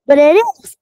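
loud pleading insistence; insisting loudly. Acted. Mouse insists to the tree.

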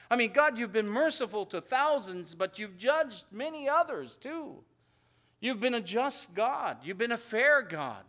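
full-force preaching. Acted. Preacher extolling God's justice and mercy to the congregation, fervent. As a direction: proclaim it to the congregation with fire.

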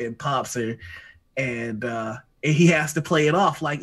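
ordinary delivery

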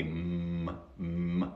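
"mm" hummed with lips shut; closed-lip M. Shown as a demonstration. Teacher shows how English M is made, hummed "mm". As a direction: hold a soft, low "mm" through closed lips. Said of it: "la M se pronuncia así".

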